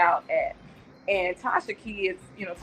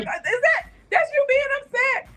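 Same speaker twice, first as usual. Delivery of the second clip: high, whiny teasing echo; hammed-up whiny mockery. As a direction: tease him by copying his whine. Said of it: "I'd be like".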